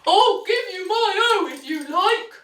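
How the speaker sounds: high, loud doggy voice; woofing dog. A narrator's reading. Dog offering the duck his letter, woofy high voice. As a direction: offer it like an eager barking dog. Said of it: "woofed the dog".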